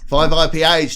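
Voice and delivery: loud call of a drink order across the room. Real, not acted. Co-host calls the drink order over to the bar, loud. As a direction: raise the voice to be heard over the room.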